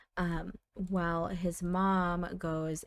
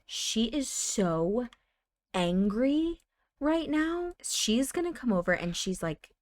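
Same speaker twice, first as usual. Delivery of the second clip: ordinary delivery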